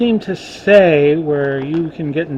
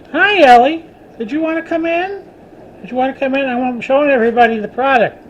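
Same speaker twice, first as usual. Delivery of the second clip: Hi, Ellie sung out much higher; pet voice; 9 semitones higher, 4 dB louder than usual. Real, not acted. He greets Ellie at the door in a much higher pet voice.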